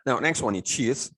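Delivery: normal talking voice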